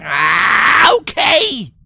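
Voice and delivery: loud frustrated outburst; exploding in frustration. Acted. Rainbow fed up with reading, exploding in a loud outburst. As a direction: snap and blow up. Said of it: "Rainbow exploded".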